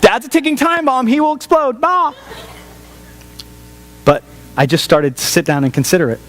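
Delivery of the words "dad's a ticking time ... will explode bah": high, quick, hammed-up wife warning the kids; mock warning wife. Wife warns the kids to keep away from dad, high mock alarm. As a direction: play the wife herding kids away from a bomb. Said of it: "I can hear my wife going".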